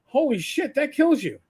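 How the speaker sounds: realizing it could have killed you; shocked realization. Retold or imitated. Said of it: "looked it up and went".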